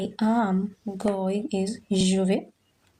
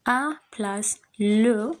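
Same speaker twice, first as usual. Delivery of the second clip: slow rule explanation; slower than usual. Shown as a demonstration. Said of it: "teacher explains contraction".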